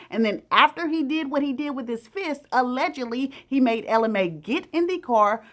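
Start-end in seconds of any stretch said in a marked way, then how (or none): none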